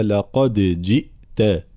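لقد جئت slow with bounced د, no merging into ج; qalqala demo. Shown as a demonstration. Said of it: "قلقلت الدال". (قلقلة الدال في لقد جئت)

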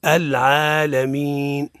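slow "العالمين" with a clean medium "عا"; long held vowels in "العالمين"; balanced recitation. Shown as a demonstration. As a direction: model "العالمين" stretched out for a class. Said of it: "ننطق به مركبا".

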